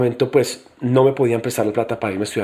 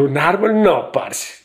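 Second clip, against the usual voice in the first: "Un árbol" pitched up in mock disbelief; incredulous; 5 semitones higher, much slower than usual. Student balking at the tree exercise, "Un árbol" incredulous. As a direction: throw back "Un árbol" high, as if it were absurd.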